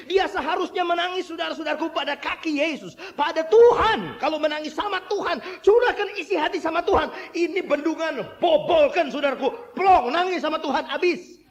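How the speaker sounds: high, loud, impassioned exhortation; full-force preaching. Real, not acted. Preacher urging the congregation to weep before God, fervent preaching.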